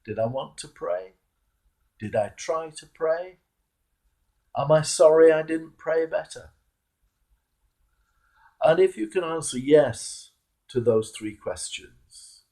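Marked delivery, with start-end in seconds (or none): none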